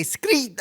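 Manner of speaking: high squeaky screech; imitated screech noise. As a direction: mimic the friend's high squawk. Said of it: "And then you say".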